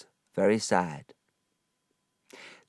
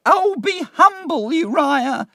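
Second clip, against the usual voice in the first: put-on much higher, pleading old mother's voice; 6 dB louder than usual. A narrator's reading. Missus Heap pleading with her son, high and begging. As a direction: beg your son to behave, high-pitched. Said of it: "Missus Heap said".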